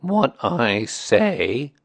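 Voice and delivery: very slow, drawn-out donkey voice; slow, aggrieved grumbler; gloomy donkey. Acted. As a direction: complain ponderously, stretching each word.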